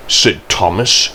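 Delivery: ordinary delivery, nothing marked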